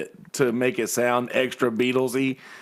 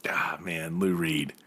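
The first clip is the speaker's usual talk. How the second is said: breathy whispered name, said almost to himself; hushed reverence; 7 semitones lower, 7 dB quieter, slower than usual. Real, not acted. Musician whispering his hero's name in awe. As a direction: whisper the name like it's sacred. Reverent aside about [name].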